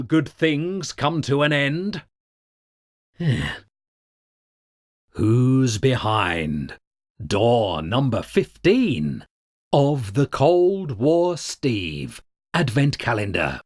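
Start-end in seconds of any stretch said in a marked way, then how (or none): 5.1-13.6 slow announcer reveal. Acted. Game-show style door reveal.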